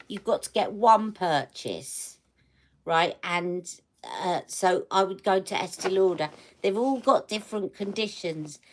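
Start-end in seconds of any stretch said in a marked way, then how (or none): none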